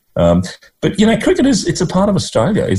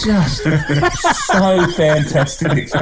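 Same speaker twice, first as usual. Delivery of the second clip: high, laughing through the words, slowing down; real laughter breaking into the words; 9 semitones higher, slower than usual. Real, not acted. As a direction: giggle with delight at the memory. Delighted memory of backyard cricket.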